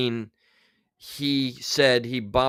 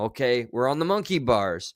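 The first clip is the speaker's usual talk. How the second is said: usual speaking voice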